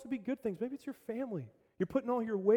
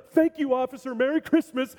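much higher, louder, beaming thanks; cheerful excitement; 7 semitones higher, 8 dB louder than usual. Acted. Dad beaming at the cop, cheerful excited thanks. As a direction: gush thanks like nothing's wrong. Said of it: "the ticket doesn't even faze him".

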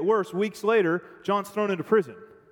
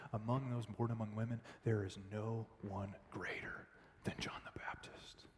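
hushed tribute; 8 semitones lower, 17 dB quieter than usual. Pretend. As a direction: hush it with reverence. Jesus praising John softly.

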